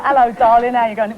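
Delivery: light Cockney greeting; a touch of Cockney, cheery; Cockney accent. Shown as a demonstration. Actress demonstrating her Cockney voice to the hosts. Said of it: "that was Cockney".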